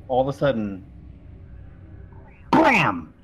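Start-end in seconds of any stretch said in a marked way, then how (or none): 2.5-3.1 shotgun blam. Acted. GM describes a shotgun blast.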